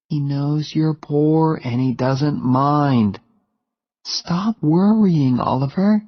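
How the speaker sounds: put-on reassuring young woman. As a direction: soothe him like a fond girlfriend. Jenny reassures Oliver.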